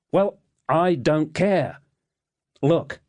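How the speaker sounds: high, slow exasperated retort. A narrator's reading. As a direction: snap back, fed up. Narrator snaps back.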